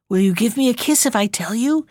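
usual speaking voice

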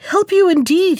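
high-pitched scornful exclamation; indignant exclaiming. A narrator's reading. Half-Chick refuses the stream's plea, exclaiming indignantly. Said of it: "he exclaimed".

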